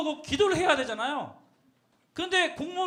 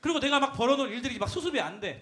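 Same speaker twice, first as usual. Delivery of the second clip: normal talking voice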